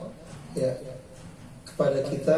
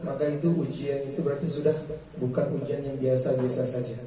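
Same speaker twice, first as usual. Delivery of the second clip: ordinary delivery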